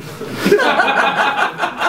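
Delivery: airy high laugh; breathy laugh. Real, not acted. Speaker laughs at story.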